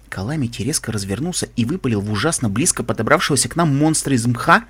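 plain reading voice

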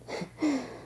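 quick breathy giggle; a little naughty giggle; soft giggle. Acted. Laughs at own twist.

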